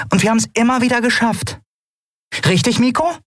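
high-pitched, confident character voice; rally your friends, high-voiced; high, brisk encouragement; encouraging Nelen. A narrator's reading. Nelen rallies the crew.

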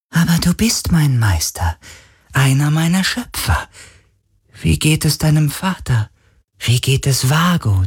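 high, eager spirit voice; devoted otherworldly servant; sea spirit, devoted. A narrator's reading. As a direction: ask fondly in a high creature voice.

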